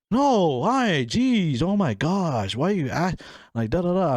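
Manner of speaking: high, flustered mock protest; defensive. Daughter denying a friend visited, acted defensive. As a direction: act put-upon, pitch swooping high. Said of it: "I was so defensive".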